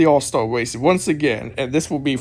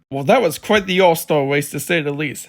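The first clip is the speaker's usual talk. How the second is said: ordinary talk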